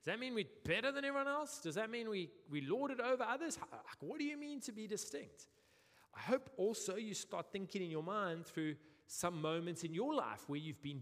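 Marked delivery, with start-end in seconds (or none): 0.0-5.5 much higher, puzzled objection; incredulous questioning. Retold or imitated. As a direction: voice the listener's doubting questions up high. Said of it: "You're going".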